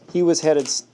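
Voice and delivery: usual speaking voice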